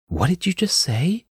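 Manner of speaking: high, rising incredulous question; startled question, pitched well up; shocked disbelief. A narrator's reading. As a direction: ask as if you can't believe your ears. Shocked reply to assassination news.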